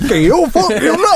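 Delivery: very high, loud cartoonish protest; over-the-top high-pitched denial; mock indignant denial. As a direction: squeal your innocence in a put-on voice. Acted indignant denial of a fart.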